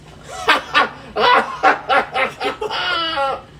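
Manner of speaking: big belly laugh; hearty laugh. Acted. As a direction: laugh helplessly at the punchline setup.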